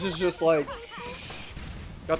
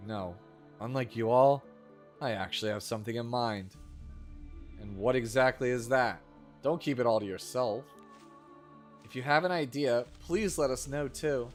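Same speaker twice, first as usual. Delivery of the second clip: bad acting; 5 semitones lower, 6 dB quieter than usual. Reads game dialogue aloud.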